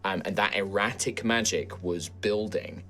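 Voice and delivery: slow, hushed scene setting; game master narration. Acted. Game master reveals the forest's anger to Gruff, hushed.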